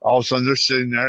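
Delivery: ordinary talk, nothing marked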